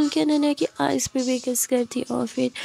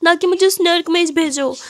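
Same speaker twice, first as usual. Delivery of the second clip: tearful plea; 5 semitones higher, 5 dB louder than usual. Acted. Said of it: "Ananya बोलती".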